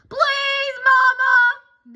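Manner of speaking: high, loud whiny scream of a plea. Acted. Cubs screaming at mother in the car, high loud plea. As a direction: scream it like panicked kids. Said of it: "they screamed".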